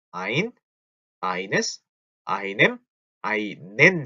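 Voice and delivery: one word at a time, with pauses; slowed-down recitation. Shown as a demonstration. Teacher slows down for learners, spaced word by word. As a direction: separate each article, stretching out "einen". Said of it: "너무 빠른가요?".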